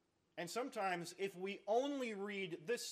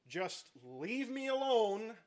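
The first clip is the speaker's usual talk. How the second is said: slow, higher, strained plea for space; mock spouse in a rage; 5 semitones higher, 5 dB louder, much slower than usual. Angry spouse telling their partner to leave them alone, strained. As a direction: hold back fury and ask for room. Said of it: "what do you say to your spouse".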